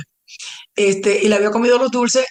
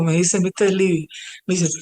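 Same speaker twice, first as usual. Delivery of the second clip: normal talking voice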